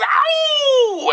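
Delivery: very high howled ow like a dog. Acted. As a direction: howl it out like a hound baying at the moon. DJ howls after his name.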